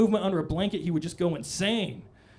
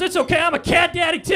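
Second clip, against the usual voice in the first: high, loud, shouted reassurance; mock proud guard; 12 semitones higher, 7 dB louder than usual. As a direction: shout it proudly, one cat daddy to another. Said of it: "he goes".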